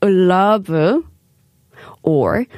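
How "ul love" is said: "love" said as "ul-love"; ul before L. Shown as a demonstration. Host inviting listeners to try the L trick, ul before L. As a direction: put an ul in front of the L of "love". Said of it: "Do you want to try it?".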